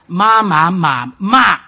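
"Ma, ma, ma, ma" very loud, pitch swinging on each; loud singsong Mandarin tone practice; tone drill. Shown as a demonstration. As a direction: run through the tones on "ma", forcefully. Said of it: "Fourth tone".

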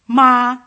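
"Ma" held high, level and loud; high level "Ma", Mandarin first tone. Shown as a demonstration. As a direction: say "Ma" on one high, steady pitch, loudly. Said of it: "The first tone is high".

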